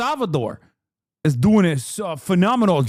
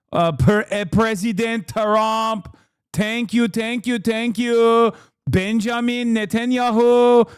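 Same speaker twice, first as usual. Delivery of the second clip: put-on thick Persian accent, slow and drawn out. Host showing off his Persian accent impression, heavy Persian accent. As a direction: lay on a heavy Iranian accent. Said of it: "I can do a pretty good Iranian".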